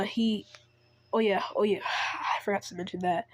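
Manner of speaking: plain reading voice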